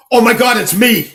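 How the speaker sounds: high, loud retold exclamation; hammed-up cry of recognition; mock shocked realization. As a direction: burst out as if it just hit you. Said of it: "and I go like this".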